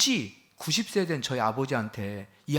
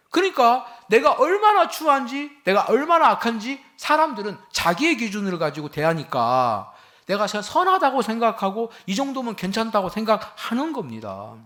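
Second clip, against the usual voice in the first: loud, high preaching, pressing each phrase; fiery preaching; 10 semitones higher, 8 dB louder than usual. Acted. Pastor preaching to his congregation, loud and urgent.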